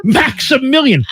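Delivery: high, drawn-out mock European accent; slow, hammed-up European dog owner. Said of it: "it was like a European accent".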